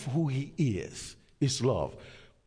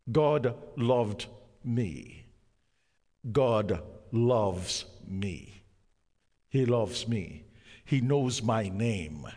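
ordinary talk, nothing marked

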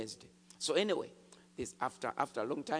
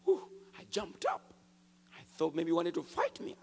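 usual speaking voice

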